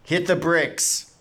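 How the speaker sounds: slow, high battle-cry yell; drawn-out catchphrase holler; in-character yell. Pretend. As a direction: stretch each word into a pretend shout. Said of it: "I yell".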